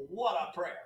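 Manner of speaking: ordinary delivery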